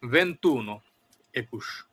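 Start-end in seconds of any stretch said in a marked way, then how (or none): 0.1-0.8 ভেন্তুনো said as Italian ventuno, falling; Italian number. Shown as a demonstration. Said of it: "Italian গণনাটা আরো বেশি স্পর্শকাতর". (ইতালীয় উচ্চারণে ভেন্তুনো)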